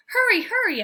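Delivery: high, loud frantic wail; loud, high puppy wail. A narrator's reading. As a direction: beg frantically to be let out. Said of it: "I wail".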